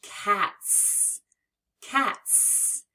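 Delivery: cats ending in a clear hissed s; modelling cats for children; voiceless final s. Shown as a demonstration. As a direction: stress the s sound in cats. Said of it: "we hear it as s".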